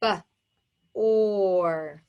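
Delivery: short "b", pause, long "or"; segmented phonics demo of "b, or"; sounded out. Shown as a demonstration. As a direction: sound out B and OR separately while writing. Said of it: "Let's write it".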